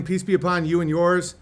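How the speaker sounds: ordinary delivery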